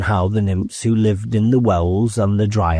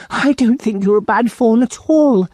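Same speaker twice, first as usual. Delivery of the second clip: put-on high, reassuring girl voice; a girl consoling a friend; reassuring young girl, higher; comforting Lucy. A narrator's reading.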